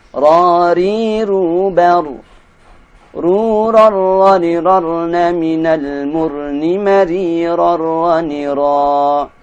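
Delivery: loud syllable drill; teacher modelling ra syllables; recitation drill. Shown as a demonstration. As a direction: chant each ra syllable clearly. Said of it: "drill syllables".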